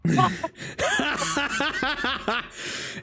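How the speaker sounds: high, helpless laugh at a friend's accent; cracking up hard; burst of laughter. Real, not acted. Friends at the table laughing at the terrible accent, helpless laughter.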